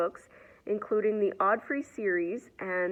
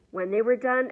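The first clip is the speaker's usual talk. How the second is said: usual speaking voice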